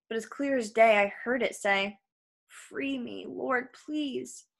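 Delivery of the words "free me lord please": very soft, pleading voice; quiet, helpless appeal; hushed plea. A narrator's reading. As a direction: beg softly for freedom. Zebra's silent plea for help.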